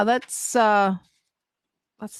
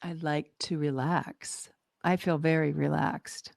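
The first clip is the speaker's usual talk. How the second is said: slow, soft and relaxed; calm relaxed; 3 semitones lower, 7 dB quieter than usual. Shown as a demonstration. Trying the new phrase, calm and slow. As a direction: let the words settle, slowly. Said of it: "Now notice the difference".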